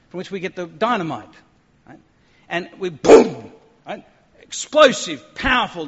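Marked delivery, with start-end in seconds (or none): 2.9-3.5 loud, drawn-out boom; imitated explosion. Preacher mimicking dynamite for the congregation, explosive boom. 4.4-5.9 loud, high, punched-out words; explosive emphasis. Shown as a demonstration. Preacher showing the congregation dynamite power, loud and explosive.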